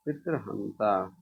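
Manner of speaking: पितृहंता drawn out very slowly and softly; slow dictation. Shown as a demonstration. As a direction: stretch पितृहंता syllable by syllable for notes. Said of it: "पितृहंता कहा जाता है".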